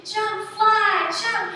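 high, very loud playful cheering; urging shout. Acted. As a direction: egg the child on to leap. Acts father urging child to jump.

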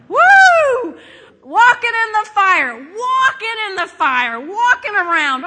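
high, very loud whoop into shouting; exultant shouting. Acted. Preacher marvelling at men walking in fire, exultant shout. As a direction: whoop and holler in amazement.

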